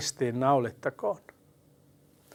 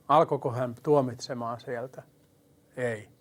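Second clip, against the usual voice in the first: normal talking voice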